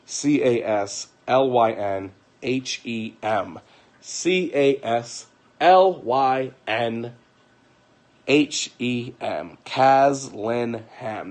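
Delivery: slow, deliberate letter by letter spelling; spelled out slowly. Shown as a demonstration. Telling viewers a Twitter handle, slowly spelled out. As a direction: spell it out so viewers can type it. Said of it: "her sister's Twitter is".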